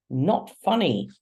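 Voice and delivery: snapping. A narrator's reading. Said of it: "she snaps".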